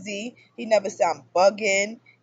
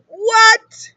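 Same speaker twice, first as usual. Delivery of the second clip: shocked disbelief; 13 semitones higher, 11 dB louder than usual. Retold or imitated. Shocked reaction to his words.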